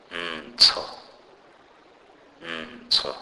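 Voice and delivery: "mtho" as a hummed M, then an aspirated TS; "mtho" said like "m-tsho", with a puff of air; aspirated TSH demonstrated. Shown as a demonstration. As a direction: model "mtho" with a breathy TS after the M. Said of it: "pronounced with an aspiration almost".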